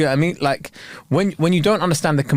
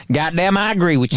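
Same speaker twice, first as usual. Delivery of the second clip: mock American accent; 2 semitones higher than usual. Acting the agreeable American passenger, mock American accent. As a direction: agree in a put-on American twang.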